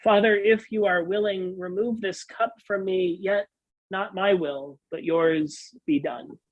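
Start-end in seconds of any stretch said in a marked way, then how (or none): none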